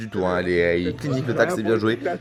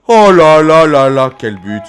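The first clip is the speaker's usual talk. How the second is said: loud, drawn-out goal cry; excited sports commentary; 5 semitones higher, 15 dB louder, slower than usual. Real, not acted. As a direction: erupt at the goal, stretching it out. Goal celebrated during commentary.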